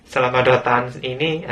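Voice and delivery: normal talking voice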